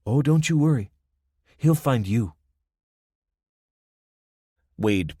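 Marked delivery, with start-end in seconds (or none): 0.1-2.3 lightly voiced Spanish accent. A narrator's reading. Young woman's ominous taunt.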